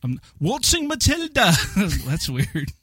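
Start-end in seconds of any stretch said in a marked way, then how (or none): 0.0-1.5 high, overdone go at Australian; mock Australian accent. Co-host told to try harder, hammed-up Australian. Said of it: "You're not even trying the accent anymore".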